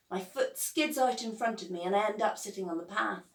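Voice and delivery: ordinary delivery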